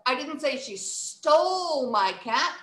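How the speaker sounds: "stole" held long; stress on STOLE. Shown as a demonstration. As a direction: draw out the word "stole". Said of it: "improve the way that we recite".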